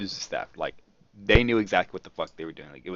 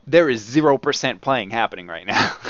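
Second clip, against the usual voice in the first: louder, firm no-nonsense warning; stern guard; 3 semitones higher, 8 dB louder than usual. Retold or imitated. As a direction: lay down the law to the thief. Guard confronting the thief.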